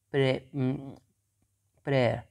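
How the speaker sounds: प्रेयर with no R at the end, British style; British pronunciation. Shown as a demonstration. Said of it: "ये ब्रिटिशर इस तरह pronounce करेंगे". (प्रेयर को British तरीके से, आर के बिना बोलें)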